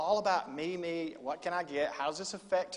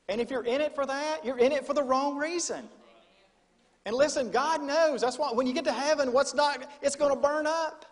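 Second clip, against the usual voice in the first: higher and louder, pressing on; preaching at full force; 7 semitones higher, 7 dB louder than usual. Real, not acted. Preacher warning congregation, higher and louder. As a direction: drive it home with fire.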